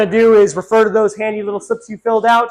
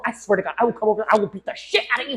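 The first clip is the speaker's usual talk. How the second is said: fast threat; 3 semitones lower, 9 dB quieter, much faster than usual. Acted. Threatening in court.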